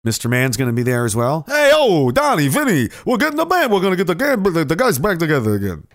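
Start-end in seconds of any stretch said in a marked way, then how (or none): 1.5-5.9 high, excited wiseguy voice; mobster. Acted. Wiseguy telling Donnie and Vinny the gang's reuniting, excited. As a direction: hype up the crew like a tough guy.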